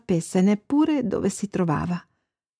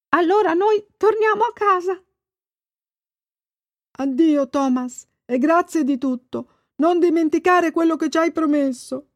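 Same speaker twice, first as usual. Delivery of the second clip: higher fish voice, grateful goodbye; fish farewell; 8 semitones higher than usual. A narrator's reading. Fish bidding Thomas a grateful farewell. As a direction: thank the boy and warn him.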